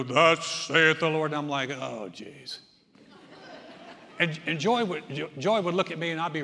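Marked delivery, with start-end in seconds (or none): 0.0-1.3 slow, louder, booming mock prophet; pompous deep voice. Preacher mimicking a windbag churchman, slow booming mock prophecy. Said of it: "He had a deep voice". 1.7-2.6 exasperated groan. Retold or imitated. His own eye-rolling reaction.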